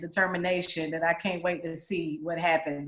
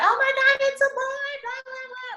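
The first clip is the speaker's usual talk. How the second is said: imitating a much higher, excited squeal; overjoyed squeal. Woman next door hearing it's a boy, imitated excited squeal. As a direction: squeal with joy at the news.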